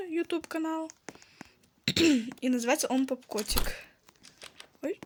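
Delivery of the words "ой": ordinary delivery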